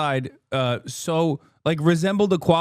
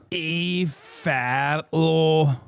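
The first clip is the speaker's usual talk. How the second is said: very slow, stretched-out repeat of the name; drawn-out name; much slower than usual. Pretend. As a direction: let the name trail out slowly. Streamer repeats name, dragged out.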